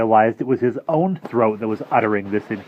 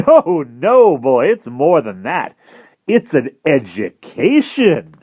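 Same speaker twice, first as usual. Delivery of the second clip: louder, slow knowing correction; a hint of sly mentor; 6 semitones higher, 9 dB louder, slower than usual. Jive corrects Harvey, slow and knowing. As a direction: savor the word education.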